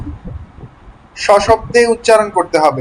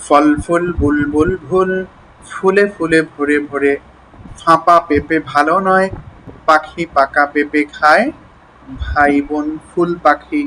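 deliberate articulation drill at a low pitch; light demo reading; 4 semitones lower than usual. Shown as a demonstration. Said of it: "হালকা করে পড়তে হবে".

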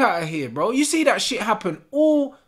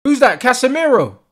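loud, higher eager questions; excited shout; 6 semitones higher, 11 dB louder than usual. Real, not acted. Fan asking who scored the goal, loud excited shout.

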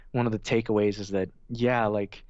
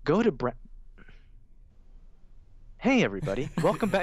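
polished host voice, pitched higher; YouTuber intro voice; 5 semitones higher than usual. Shown as a demonstration. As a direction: restart the intro like a slick host. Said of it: "it's exactly like that".

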